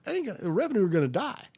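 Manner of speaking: half-joking alarm, pushed up and out. Retold or imitated.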